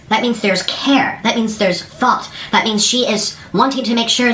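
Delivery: slow emphasis. Real, not acted. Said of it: "emphatic explanation".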